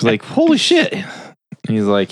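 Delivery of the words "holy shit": high-pitched mock amazement; amazed. He hears it was a year ago, imitated amazement. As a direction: imitate a stunned reaction.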